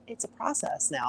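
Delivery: soft mocking quote; imitated pet phrase. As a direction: drop to a hushed imitation. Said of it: "Anytime someone says".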